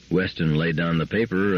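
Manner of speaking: usual speaking voice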